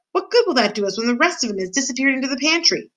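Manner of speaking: high-pitched scolding question; exasperated shout. A narrator's reading. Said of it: "shouted Cook".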